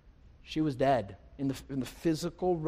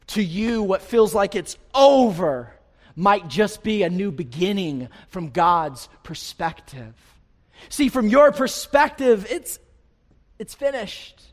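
loud, high, impassioned sermon delivery; raised pulpit voice, driving the contrast home; full-force preaching; 5 semitones higher, 11 dB louder than usual. Real, not acted.

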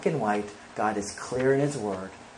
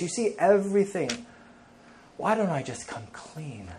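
higher, pleading confession to God; humble prayer; 6 semitones higher, 4 dB louder than usual. Pretend. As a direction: pour out the heart before the Lord. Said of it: "a choice to humbly say".

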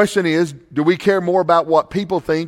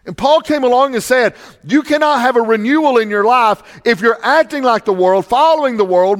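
raised, urgent pulpit voice; preaching at full force; 8 semitones higher, 5 dB louder than usual. Real, not acted. Pastor warning the church about the culture, preaching hard. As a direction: drive the warning home to the congregation. Preacher quotes Paul, builds point.

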